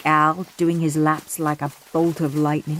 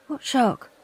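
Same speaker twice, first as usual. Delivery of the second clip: anxious; 5 semitones higher, 4 dB quieter than usual. Acted. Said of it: "said anxious Andrew".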